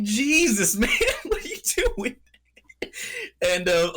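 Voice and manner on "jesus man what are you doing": laughing disbelief. Retold or imitated. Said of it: "it's like".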